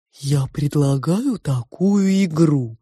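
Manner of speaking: slow, drawn-out sow voice; probing pig. A narrator's reading. The pig judges the girl's drawing, probing. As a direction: state it slowly, stretching every word.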